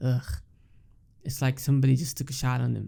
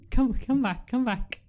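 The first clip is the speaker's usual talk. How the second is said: higher, laughing plea to come back; laughing call; 10 semitones higher than usual. Real, not acted. Laughing while calling sister.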